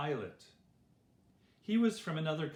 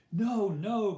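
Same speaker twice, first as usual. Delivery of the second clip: loud, higher-pitched cry of protest; 7 semitones higher, 8 dB louder than usual. A narrator's reading. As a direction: cry it out in firm objection. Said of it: "cried the rulers".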